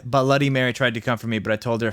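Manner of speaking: usual speaking voice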